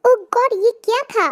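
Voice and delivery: high, startled exclamation; shocked. Acted. Tae to Jungkook after the branch falls, shocked and high. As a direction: react to the sudden crash in alarm. Branch crashes in front of car.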